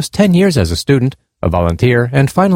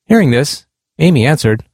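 ordinary delivery

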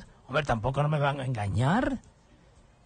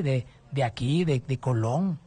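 ordinary talk, nothing marked

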